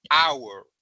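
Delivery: "hour" said ow-er with a silent H; model pronunciation. Shown as a demonstration. Teacher modelling "hour" with a silent H for the class. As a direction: start "hour" straight on the vowel, no H. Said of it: "la pronunciación de hora es".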